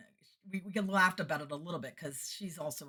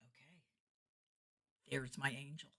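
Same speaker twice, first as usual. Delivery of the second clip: softer, understated murmur; quiet realization; 9 dB quieter than usual. Retold or imitated. Entertainer spotting her prayed-for helper, quietly realizing. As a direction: say it softly to yourself.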